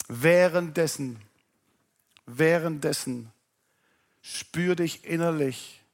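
slow, soft, inward confession; soft reflective testimony. Acted. Preacher to congregation, recalling grace, slow and soft. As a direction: let it sink in quietly, slowly.

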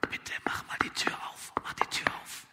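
begging under the breath; pleading whisper. Acted. Friend begging the father to open the door, pleading whisper. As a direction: whisper a plea.

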